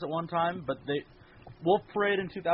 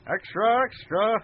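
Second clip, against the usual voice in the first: newsboy call; 8 semitones higher, 4 dB louder, slower than usual. Pretend.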